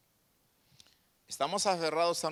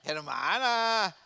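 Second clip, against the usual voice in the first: mock reproach; much slower than usual. Real, not acted. Mock reproach to laughing sister.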